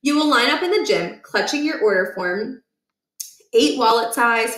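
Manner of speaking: plain reading voice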